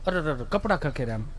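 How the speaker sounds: put-on soft, hushed cooing to a child; soothing coo. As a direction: dust the kid off and coo softly. Parent soothing fallen child. (બાળકને ધીમેથી પંપાળતો અવાજ)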